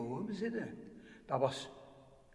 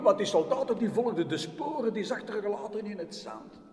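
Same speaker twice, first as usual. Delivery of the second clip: plain reading voice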